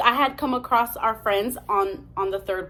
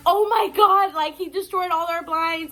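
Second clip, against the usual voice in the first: retold alarm; 6 semitones higher than usual. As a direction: re-enact an upset phone call to a friend. Retold upset call to friend.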